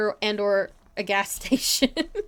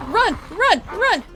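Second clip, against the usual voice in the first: high, loud repeated yelps; panicked yell; 11 semitones higher, 8 dB louder, slower than usual. Real, not acted. Player urges her character away from zombies, panicked. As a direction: yell it urgently to escape.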